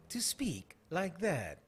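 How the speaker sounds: drawn-out mock reporter cadence; parodied swooping presenter delivery; air hostess voice. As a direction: stretch it like a cabin announcement. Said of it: "Why do they speak like air hostesses".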